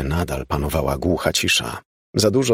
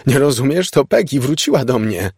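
high, loud, frantic insistence; shouting; 7 semitones higher, 4 dB louder than usual. A narrator's reading. Mother shouts to father that Peggy returned, shouting. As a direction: shout it at him, voice raised. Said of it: "krzyknęła do niego".

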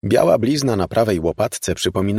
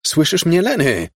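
high, urgent, raised question; calling out; 10 semitones higher, 4 dB louder than usual. A narrator's reading. Woman tries to reach her dazed lover, calling loudly. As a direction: call insistently, pitch soaring. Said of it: "zawołała".

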